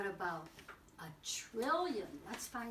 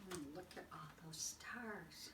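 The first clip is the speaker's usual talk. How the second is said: hushed whisper of wonder; soft storytime whisper; 4 semitones lower, 10 dB quieter than usual. Acted. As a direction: breathe it like a secret under the stars. Floating past stars, hushed.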